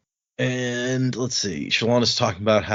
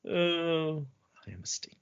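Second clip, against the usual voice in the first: a tiny, much softer whisper for a mock stick; 5 semitones higher, 9 dB quieter than usual. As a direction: whisper as if the stick itself replied.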